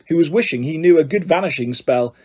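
usual speaking voice

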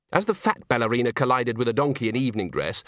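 ordinary delivery

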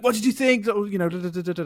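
high, eager mock excitement; imitating an excited friend after her play; excited actress. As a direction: ask like a thrilled actress fishing for praise. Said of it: "all excited".

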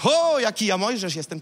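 hammed-up self-congratulation; self-admiring boast. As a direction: gush in mock awe at your own greatness. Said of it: "i nie mówisz".

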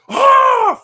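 long, very high, very loud war cry; battle yell. Retold or imitated. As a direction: let out a charging Viking scream. Imitating charging axe attacker.

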